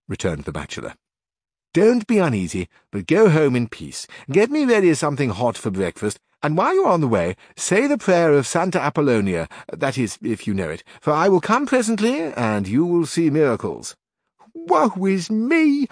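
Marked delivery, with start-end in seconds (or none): 1.7-14.0 louder, higher confident bachelor; a hint of soothing certainty; reassuring bachelor. A narrator's reading. Carrasco sends the housekeeper home, louder and confident. 14.5-15.9 high, slow, drawn-out wail; cried out. A narrator's reading. Housekeeper cries out in dismay, high drawn-out wail. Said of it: "cried the housekeeper".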